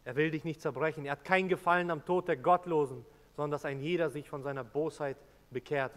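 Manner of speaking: ordinary delivery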